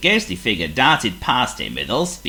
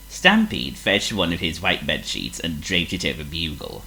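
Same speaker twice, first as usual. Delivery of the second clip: ordinary delivery, nothing marked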